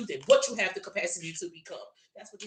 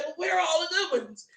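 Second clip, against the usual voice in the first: mock whiny complaint; 9 semitones higher than usual. Mocking women complaining about men.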